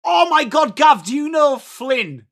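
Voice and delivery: high, loud burst of excitement; thrilled to see a name in chat; excited. Real, not acted. Streamer to chatter Gav, excited shout.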